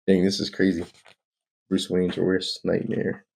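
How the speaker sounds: usual speaking voice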